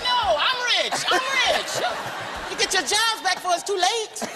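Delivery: high sing-song no I'm rich; mock insistent. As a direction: correct them playfully. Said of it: "I was like".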